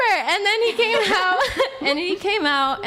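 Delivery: high, slow words broken by laughter; laughing. Real, not acted. She tells friends how her boyfriend came out, laughing. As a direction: laugh through the line as you tell it. Laughing while retelling the scene.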